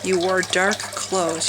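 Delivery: ordinary talk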